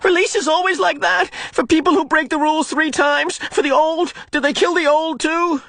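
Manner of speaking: high, shaken, pleading questions; reeling from the truth; boy horrified, pleading for answers; distraught. Acted.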